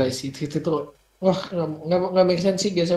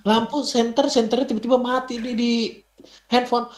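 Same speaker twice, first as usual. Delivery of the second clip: imagined panic; 8 semitones higher than usual. Pretend. Imagining being locked in, lights out.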